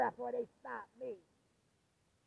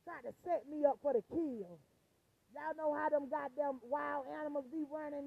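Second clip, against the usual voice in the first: usual speaking voice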